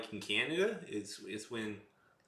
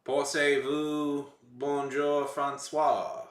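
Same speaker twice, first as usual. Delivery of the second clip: mock Italian; 5 semitones higher, 8 dB louder, much slower than usual. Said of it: "Pretty darn good at that Italian".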